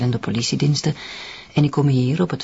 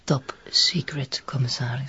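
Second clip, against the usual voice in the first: secret whisper. Acted. Agent whispering to the commissioner, secretive hush.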